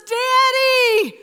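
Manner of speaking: high, loud, drawn-out call for Daddy; child crying out. Retold or imitated. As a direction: imitate a scared little girl yelling downstairs. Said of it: "causes Monica to cry out again".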